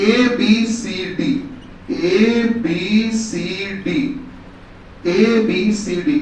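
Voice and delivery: spelled out slowly, letter by letter; slow dictation. Shown as a demonstration. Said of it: "लिखो बेटा". (धीरे धीरे बोलकर लिखवाना)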